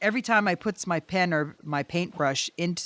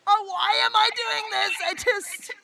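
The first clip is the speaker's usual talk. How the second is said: high, panicked, half-laughing wail; panicky disbelief; 10 semitones higher than usual. Retold or imitated. Panic attack over the artist across the street, high fretful wail. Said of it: "I'm like".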